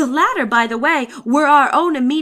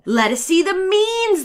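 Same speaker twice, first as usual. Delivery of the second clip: higher, slowed demand of pedants; mock cry; 6 semitones higher, 3 dB louder than usual. A narrator's reading. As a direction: sneer out their slow, insistent demand. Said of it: "they cried".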